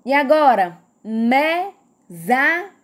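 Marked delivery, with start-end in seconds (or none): 1.0-2.7 "Mesada" slowly split as me, sa, da; three drawn-out beats in "Mesada"; syllable by syllable. Shown as a demonstration. Teacher giving another example, "Mesada" said in separated syllables. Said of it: "a gente pronunciou quantas sílabas?".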